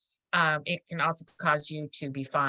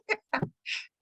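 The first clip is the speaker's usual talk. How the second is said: high burst of laughter; 5 dB quieter than usual. Real, not acted. Bursts out laughing mid-story.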